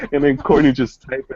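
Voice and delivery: ordinary talk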